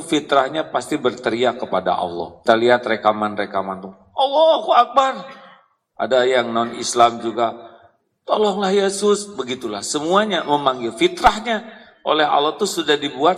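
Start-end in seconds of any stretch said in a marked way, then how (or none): 4.1-5.2 high, loud cry of alarm; panicked cry to God. Retold or imitated. People in a disaster crying Allahu Akbar. 8.3-9.3 high, pleading cry for help; desperate plea. Retold or imitated. Non-Muslims crying for help.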